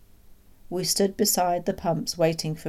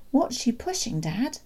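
put-on higher-pitched child's question; curious boy; 7 semitones higher than usual. Boy asking his father about the pram, curious. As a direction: ask Dad with curiosity.

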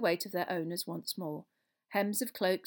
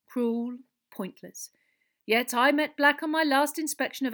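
raised, puzzled minister's account; Fudge, unnerved; 6 semitones higher, 7 dB louder than usual. Acted. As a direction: build from sombre to astonished.

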